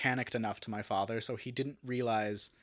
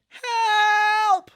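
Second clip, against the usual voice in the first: long, high, loud yell; yelled cry for help; 12 dB louder than usual. Retold or imitated. Trapped driver calling to people for help, loud drawn-out yell. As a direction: draw out one big high shout for help. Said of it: "I just start yelling".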